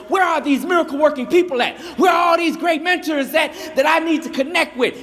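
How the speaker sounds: high, loud and eager; excited loud youth pleading for mentors; eager shouted question. Acted. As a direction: call out hungry for answers. Eager youth asks store clerk.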